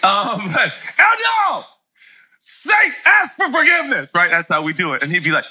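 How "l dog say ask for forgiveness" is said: dad yelling an order at his son; parent yelling. Retold or imitated. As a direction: call the son out firmly. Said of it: "Do you yell like that".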